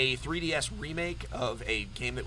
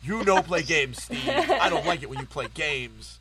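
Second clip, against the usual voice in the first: laughing; 6 dB louder than usual. Real, not acted. Mock scolding after roast.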